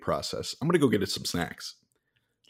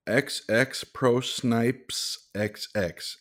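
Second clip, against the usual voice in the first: slow spelling; much slower than usual. Real, not acted. Slowly spelling out a gamertag.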